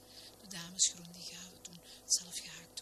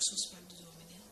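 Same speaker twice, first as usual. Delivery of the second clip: plain reading voice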